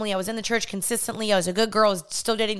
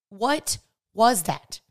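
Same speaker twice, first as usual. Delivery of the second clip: very slow, drawn-out puzzled "What was that?"; slow bewildered question; much slower than usual. Retold or imitated. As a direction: stretch it out, honestly lost. Genuinely confused child, retold.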